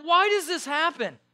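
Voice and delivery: loud, high mock lament; exasperated wail. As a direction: cry it out in hammed-up frustration. Acted exasperation after losing temper.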